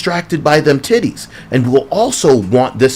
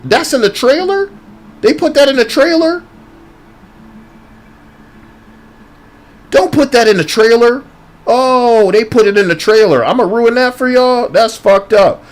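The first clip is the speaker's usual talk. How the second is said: shocked disbelief; 9 semitones higher than usual. Real, not acted. Outraged at the trailer.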